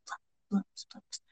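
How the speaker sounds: whispered echo trailing away; hushed, voiceless echo. A narrator's reading. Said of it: "echo around the hall".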